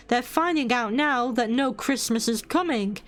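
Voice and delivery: ordinary delivery, nothing marked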